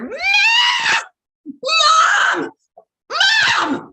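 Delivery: high, loud shouts of Mom; child yelling upstairs. Retold or imitated. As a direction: scream for mom from the top floor.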